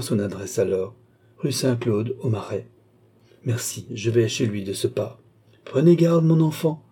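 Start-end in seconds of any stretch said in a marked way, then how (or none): 5.6-6.8 higher, louder exclamation of warning; raised-voice, urgent caution; urgent warning cry. A narrator's reading. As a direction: cry out to hold someone back. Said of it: "s'écria Rousseau en le retenant".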